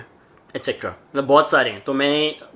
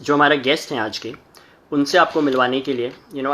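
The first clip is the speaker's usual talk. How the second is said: ordinary talk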